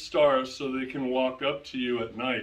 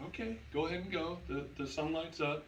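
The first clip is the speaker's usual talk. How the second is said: quick, much softer, higher murmur; hushed reply; 3 semitones higher, 9 dB quieter, faster than usual. Retold or imitated. Dad sending his little son out to go potty, hushed. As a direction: re-enact a half-asleep parent keeping it down.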